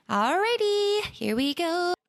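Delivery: high, bouncy sing-song; playful sing-song. Real, not acted. Host playfully launches interview.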